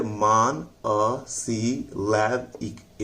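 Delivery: मान अ सी लैब एक spoken slowly, each syllable split apart. Shown as a demonstration. Teacher sounding out each syllable for students. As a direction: break मान अ सी लैब एक into separate beats. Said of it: "लैब पर जोर लगाना है".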